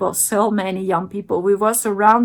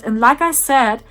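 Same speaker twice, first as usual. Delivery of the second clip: ordinary talk, nothing marked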